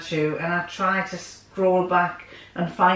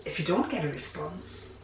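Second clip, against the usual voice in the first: much softer repeat of "if you don't get a response"; quiet; 10 dB quieter than usual. Real, not acted. Quiet take repeating it to her viewers. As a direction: drop the voice right down on the repeat.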